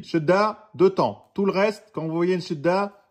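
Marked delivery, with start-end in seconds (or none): none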